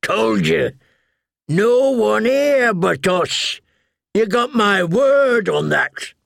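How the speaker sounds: put-on stubborn old crow answering; gruff old soldier. A narrator's reading. Yoren answers.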